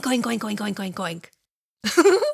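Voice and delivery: pig oinks. Shown as a demonstration. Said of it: "Let's all say oink".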